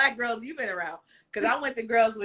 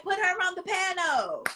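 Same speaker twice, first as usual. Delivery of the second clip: insistent demand; 9 semitones higher, 5 dB quieter than usual. Pretend. Excited crowd wanting her on the panel, playfully insistent. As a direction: cry it out like an eager crowd.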